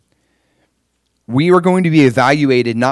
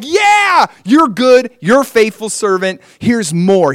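high, bright praise from God; mock God's hearty approval; God praising; 8 semitones higher, 3 dB louder, slower than usual. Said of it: "They're like".

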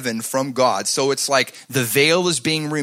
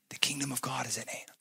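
fast hushed whisper; 13 dB quieter, faster than usual. Acted. Pastor whispers Jesus' message to the congregation. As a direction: breathe it like a secret. Confidential aside in sermon.

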